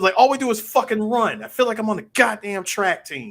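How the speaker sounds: loud, high exasperated outburst; retold rant at its peak. Retelling the character's rant about running, loud and exasperated. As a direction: act out a fed-up tirade. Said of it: "getting to his rant".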